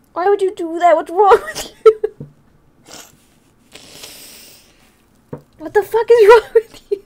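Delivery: high voice breaking into laughter; overwhelmed tearful laughing. Real, not acted. Streamer to generous gifter, laughing and crying in disbelief. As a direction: scold through tears and laughter, pitch climbing.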